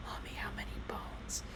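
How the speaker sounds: whispered plea to mom; a little boy whispering for help; child's whisper. Retold or imitated. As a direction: whisper it secretly to your mother. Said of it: "turns to me and goes".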